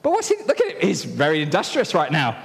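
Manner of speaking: very high, excited commentary; children's-talk excitement; animated storyteller. Acted. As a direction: play it up for the children.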